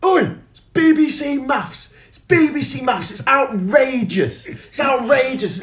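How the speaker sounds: high, loud mock Brexiteer heckle; hammed-up heckler yelling outrage; mock heckler shout. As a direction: bellow it from the back of the room. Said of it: "shouting from the back".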